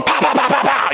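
rapid high "pow" sound effects; imitated swatting noise. Dad retelling swinging the switch, imitated smacks. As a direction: act out a flurry of swats. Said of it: "I'd take the switch".

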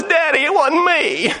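put-on high, drawn-out child's plea; protesting child. Child to dad after a swat, mock plaintive protest. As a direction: mimic a wrongly punished kid pleading innocence. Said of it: "one of them says".

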